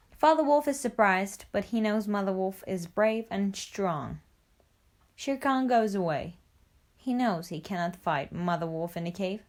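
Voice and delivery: ordinary delivery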